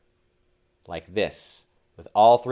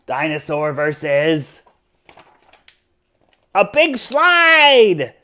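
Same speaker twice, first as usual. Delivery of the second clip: plain reading voice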